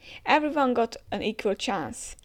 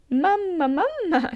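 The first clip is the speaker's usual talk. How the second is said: high and loud, a big matriarch's laugh; booming mamma laugh; 9 semitones higher, 5 dB louder than usual. Acted. Reading the big mother's lines, loud mamma laugh.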